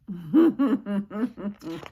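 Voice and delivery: a real amused laugh, falling away; warm laugh. Real, not acted. Reader laughing at the dogs licking the kitchen clean, amused.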